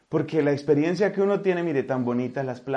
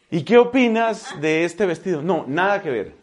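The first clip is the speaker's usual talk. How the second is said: put-on louder, higher teen girl chatter with laughs; mocking teen girls; 4 semitones higher, 9 dB louder than usual. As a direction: mimic two teens chatting about dresses. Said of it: "ellas no dicen sí, sino dicen obvio". (imitación burlona de chicas adolescentes, más fuerte)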